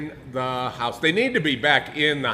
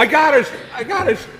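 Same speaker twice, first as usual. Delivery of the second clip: laughing shout; 12 semitones higher, 6 dB louder than usual. Real, not acted. Laughing excited outburst.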